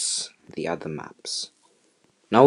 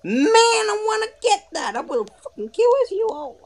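frustrated cry. Real, not acted. Raging after losing.

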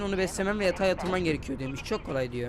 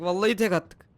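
louder, rising excitement; excited boast; 3 semitones higher, 6 dB louder than usual. Real, not acted. Player boasting about a single throw, loud and excited. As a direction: burst out louder on the repeat.

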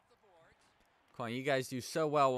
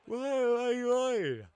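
loud, very high drawn-out "why"; wailing protest; 10 dB louder, much slower than usual. Real, not acted. Player protesting at his hockey game, wailing loudly. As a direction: cry out in high-pitched exasperation.

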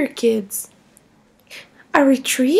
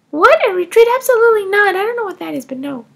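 higher, louder outraged no; loud child refusal; 4 semitones higher, 5 dB louder, faster than usual. Acted. [name] loudly refusing the retreat with her mother. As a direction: reject it flat out like a kid.

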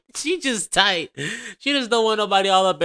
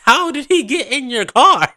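laughing loud; 7 semitones higher, 7 dB louder than usual. Real, not acted. Laughing mock of the woman.

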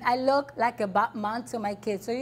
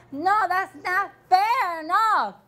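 slow, raised, pressing every word; louder and slower, fed up; indignant outburst; 6 semitones higher, 4 dB louder, slower than usual. Real, not acted. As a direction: hammer home that it's unfair. Mother furious over her kids.

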